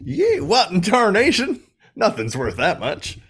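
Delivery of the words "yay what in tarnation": high, hammed-up hillbilly yelp; jokey yokel outrage; mock cowboy. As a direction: yelp it like an old prospector.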